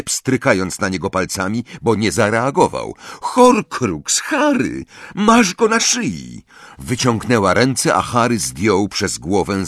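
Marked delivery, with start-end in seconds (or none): 3.2-6.4 high, emphatic insistence; impatient. A narrator's reading. Hermione prods an unresponsive Harry, impatient. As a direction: spell it out urgently, pitch up. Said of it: "dodała niecierpliwie".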